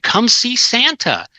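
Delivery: high, slow, drawn-out come-on; mock sign invitation. Storyteller reads out a Winter Wonderland sign, mock inviting.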